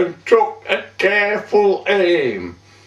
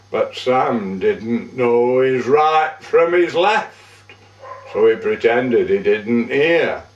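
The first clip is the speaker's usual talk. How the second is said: plain reading voice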